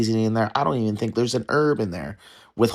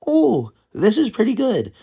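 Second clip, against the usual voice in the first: much higher, prim mock-polite voice; mimicking polite relatives; 11 semitones higher than usual. Mocking his polite family over gluey potatoes. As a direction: ham up the family's dutiful compliment.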